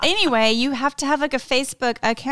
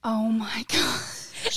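breathless whispered disbelief; stunned whisper; 5 dB quieter, much slower than usual. Real, not acted. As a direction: whisper it, floored. Reacting in disbelief.

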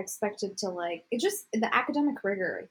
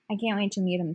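ordinary talk, nothing marked

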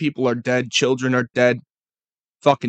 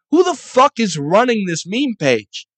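higher, louder exasperated demand; exasperated rant; 8 semitones higher, 5 dB louder than usual. Real, not acted. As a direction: rage at whoever runs the page. Ranting at meme pages.

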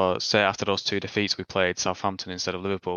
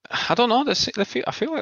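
high exasperated outburst; 3 dB louder than usual. Real, not acted. Asked about Henderson, bursts out.

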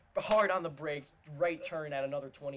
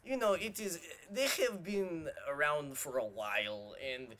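put-on higher, a light Russian accent; Russian driver impression; 6 semitones higher than usual. Quoting the Russian Uber driver to friends, light Russian accent. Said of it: "And he was like".

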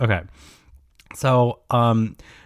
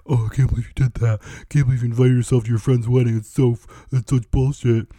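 mock scolding listeners. Imitating listeners scolding her over the wedding, fast and snippy. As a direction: rattle it off like a preachy critic. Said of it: "you're all like".

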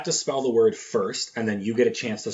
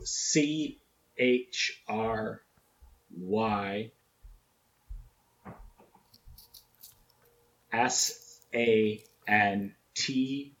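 slow spelling out; 5 dB quieter, much slower than usual. Real, not acted.

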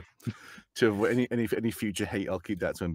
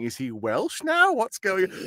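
drawn-out puzzled question, rising higher; retold incredulous reaction; mock bafflement; 7 semitones higher, slower than usual. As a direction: stretch it out as a baffled onlooker. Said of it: "people were saying".

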